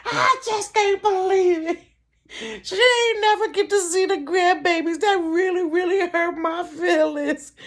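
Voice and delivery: high, hammed-up weepy lament; crying. Grieving that mama missed the grandbabies, tearful. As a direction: wail it like a mock mourner. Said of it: "Now I'm crying".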